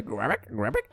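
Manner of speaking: low quiet croaky frog; frog croak. Pretend. Imitating frog again.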